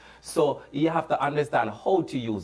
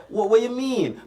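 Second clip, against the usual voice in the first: higher and louder, baffled; mock puzzled surprise; 12 semitones higher, 6 dB louder than usual. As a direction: play it surprised, as if you never noticed the accent. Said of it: "and you say".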